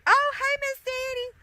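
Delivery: very high, loud country-Southern greeting; put-on extreme country Southern accent; extremely country accent. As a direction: holler a hello in exaggerated high-pitched country twang. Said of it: "the fourth is extremely country".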